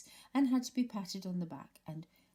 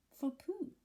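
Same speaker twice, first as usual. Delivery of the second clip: surprised; 11 semitones higher than usual. A narrator's reading. Eeyore surprised it isn't his.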